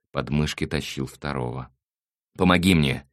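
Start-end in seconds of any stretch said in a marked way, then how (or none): 2.4-3.1 loud, higher-pitched order; curt raised-voice command. A narrator's reading. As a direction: order an accomplice to help. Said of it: "велел он".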